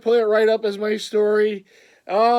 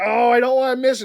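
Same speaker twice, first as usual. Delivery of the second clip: eager rush; 5 semitones higher, 7 dB louder, faster than usual. Retold or imitated. Panic buying the hat.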